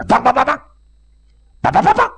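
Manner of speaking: high, rapid-fire "bang" bursts; imitated gunfire. Officer describing an ambush from behind, imitated high gunfire. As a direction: rattle off shots in a high voice.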